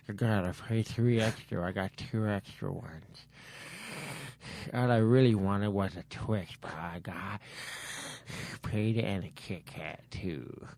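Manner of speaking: low, soft character voice; candy thief voice. Retold or imitated. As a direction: mumble to yourself as the imitated candy thief. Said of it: "I'd like for hear you do that voice".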